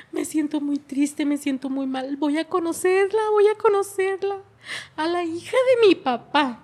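re-enacting an upset daughter, building; retold tearful outburst. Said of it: "me dijo llorando".

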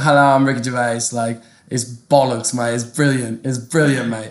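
put-on English accent, matey catchphrases; Ricky Gervais. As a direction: do a comic British bloke's accent. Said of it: "the other accent, which is, like, Ricky Gervais".

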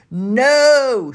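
high, loud, drawn-out shout; shouted refusal. A narrator's reading. All the others veto Danny's topping, shouting. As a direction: holler it as a whole outraged group. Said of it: "shouted all the others".

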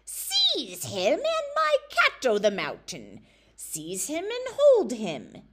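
high, loud, slow witch's scream. Acted. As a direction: shriek the order at full force. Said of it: "Aoife screamed out loud".

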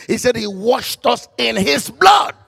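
ordinary delivery

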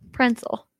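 Pretzel said as "prentzel", with an extra N; quirky pronunciation. Shown as a demonstration. Woman says Pretzel on request for teasing friends, as "prensel". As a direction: slip an N into Pretzel: "PREN-sul". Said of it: "You should see how she says pretzel".

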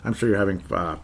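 ordinary talk, nothing marked

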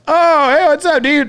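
high, over-eager hello; retold rowdy greeting. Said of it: "just the three of us going nuts".

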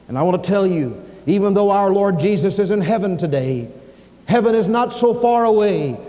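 rising, loud preaching voice; fervent preaching. Acted. Pastor warning the congregation about unbelief, fervent preaching. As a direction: preach it with urgency.

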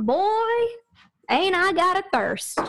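put-on high drawling town drunk. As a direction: play the thirsty town drunk. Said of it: "Malloy stumbled into the bar saying".

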